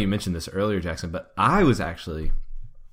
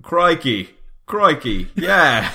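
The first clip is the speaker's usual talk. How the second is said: hammed-up British exclamation, higher and louder; mock British; 6 semitones higher, 6 dB louder, much slower than usual. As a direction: blurt it like a cartoon Brit. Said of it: "[name], are you British?".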